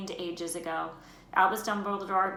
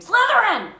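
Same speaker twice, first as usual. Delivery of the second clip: much higher and much louder scream of the house name; hat screaming; 14 dB louder than usual. Acted. The Sorting Hat screaming Malfoy's house the moment it touches him. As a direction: scream it out at once. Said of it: "it screamed".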